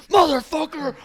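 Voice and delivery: high, loud enraged yell imitating the tattooer; freaking out. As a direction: explode in a furious high-pitched outburst. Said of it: "he starts freaking out".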